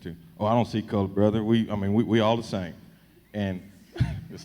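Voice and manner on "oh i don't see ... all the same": mock country folks saying it; playful Texas country accent. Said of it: "this is my country accent".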